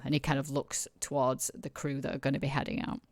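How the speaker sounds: ordinary talk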